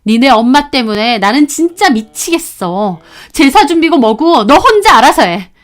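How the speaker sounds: building from heated to a loud, high shout; wife blowing up at her husband about the in-laws; furious outburst. Acted. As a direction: explode at the husband and walk away. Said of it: "저는 화가 머리끝까지 나서".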